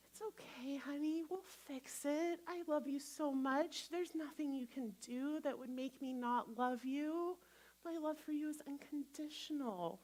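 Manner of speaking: hushed tender parent voice; quiet, unconditional tenderness; soft, loving reassurance. Shown as a demonstration. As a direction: comfort a child softly and lovingly. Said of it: "That's how I want you to speak to yourself".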